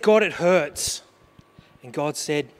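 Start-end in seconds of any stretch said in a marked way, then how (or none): 0.0-1.0 louder cry of pain to God. Retold or imitated. Father crying out to God in the hospital, loud. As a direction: call out the hurt to heaven. Preacher quoting his prayer to God.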